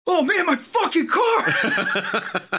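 high, loud, drawn-out outraged yell; angry shout. Retold or imitated. Car owner shouting up from the parking lot, outraged. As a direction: bellow it like your new car just got wrecked.

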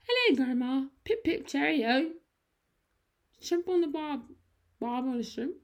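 high, hammed-up British accent; exaggerated high-pitched British accent. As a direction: imitate a niece greeting Grandma in mock British. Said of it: "how to do a British accent".

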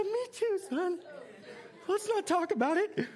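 high, teary, laughing plea to drop it; mock choked-up dad. Dad begs his son to drop the topic, mock teary. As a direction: ham up a dad about to cry again. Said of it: "I said".

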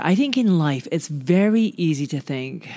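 ordinary delivery

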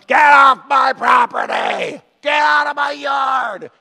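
put-on high, slow, drawn-out old-man shout; play-scare the kids as a cranky neighbour; hammed-up get-off-my-lawn yell; grumpy old guy. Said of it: "I'm the grumpy old guy".

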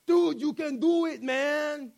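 badly faked Russian accent, urging a friend on. Workout buddy cheering him on, rough Russian accent. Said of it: "he had this Russian accent".

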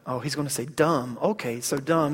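low, soft thinking aloud; mother's private aside. Retold or imitated. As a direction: say it quietly to yourself. Mother's relieved thought to herself.

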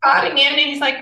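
ordinary delivery

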